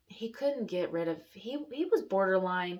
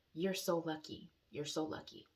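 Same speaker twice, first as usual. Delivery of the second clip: soft, lower-key quoted verdict; quoted doctor; 3 semitones lower, 7 dB quieter than usual. Retold or imitated. Doctor telling the injured patient her luck, quiet retold verdict.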